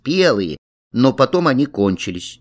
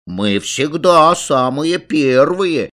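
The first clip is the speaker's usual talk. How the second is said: put-on slow, high childish boasting; self-satisfied little braggarts; proud boast; 4 semitones higher, 4 dB louder, slower than usual. A narrator's reading. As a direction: draw it out proudly like a kid. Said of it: "загордились Саласкины".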